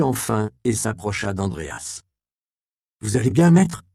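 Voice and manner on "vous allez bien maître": put-on higher, louder worried young voice; calls out to his wounded master; young Robin, anxious. A narrator's reading. (jeune Robin inquiet, plus aigu et plus fort)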